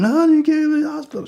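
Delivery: sudden high-pitched mock wail; drunk protest. Drunk woman refusing the hospital, high mock protest. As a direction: imitate a drunk woman objecting. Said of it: "She's so drunk".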